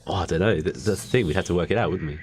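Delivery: ordinary delivery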